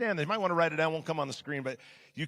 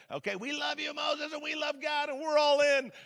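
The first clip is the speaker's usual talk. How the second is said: eager crowd voice; 11 semitones higher than usual. Pretend. Voicing the eager tribe to Moses.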